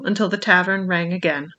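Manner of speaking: usual speaking voice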